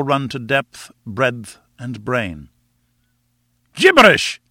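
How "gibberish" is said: loud, high explosive outburst; angry cry. A narrator's reading. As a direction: shout it scornfully. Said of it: "he cried".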